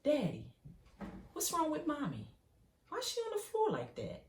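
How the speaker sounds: put-on a little kid asking about mommy, pitching up; worried small child. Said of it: "you was like".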